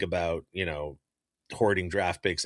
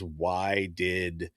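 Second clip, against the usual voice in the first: usual speaking voice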